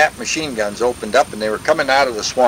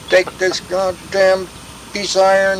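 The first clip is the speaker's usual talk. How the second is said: slow, raised-pitch retold order; angry officer; 5 semitones higher than usual. Officer orders the tank driver, retold anger. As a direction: imitate a mad officer ordering a tank crew. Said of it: "He was really mad".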